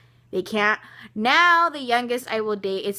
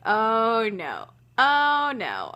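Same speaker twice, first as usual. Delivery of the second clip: two sinking oh-nos, the second more forceful; retold dismay; 4 dB louder, much slower than usual. Retold reaction to bad news.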